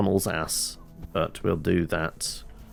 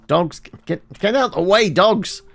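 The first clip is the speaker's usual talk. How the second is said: raised, higher shout at the dogs; calling out; 10 semitones higher, 7 dB louder than usual. Real, not acted. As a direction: holler to clear the dogs out of the way.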